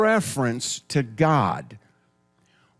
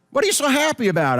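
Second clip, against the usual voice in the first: teasing question; 10 semitones higher, much faster than usual. Retold or imitated. Acted quip at a grumpy face.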